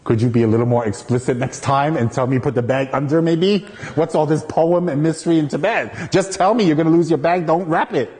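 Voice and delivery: high, sarcastic griping at the teacher; mock angry inner voice, high and peeved; indignant inner rant. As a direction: vent it like a resentful student in his head. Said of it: "my evil mind was thinking".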